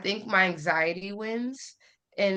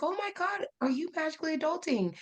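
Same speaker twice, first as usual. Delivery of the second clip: much higher, fast, gushing; excited fan; 9 semitones higher, 5 dB quieter, faster than usual. Retold or imitated. Follower running up to the creator, gushing and high-pitched. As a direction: squeal it like you just spotted a favourite creator. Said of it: "she ran up to me and she's like".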